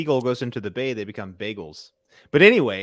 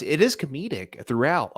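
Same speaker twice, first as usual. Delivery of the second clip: usual speaking voice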